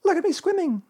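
very high squeak; Roo. A narrator's reading. Little one showing off in the pool, squeaking. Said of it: "squeaked Roo from the middle".

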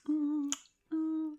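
moaning. Acted. Moaning during intimate roleplay.

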